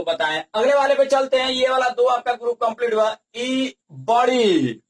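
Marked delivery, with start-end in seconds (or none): 3.4-4.8 ई and बड़ी drawn out very slowly; long vowel. Shown as a demonstration. Teacher showing how the big vowel is said, ई held long. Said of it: "इसको बड़ी ई ऐसे करके बोला".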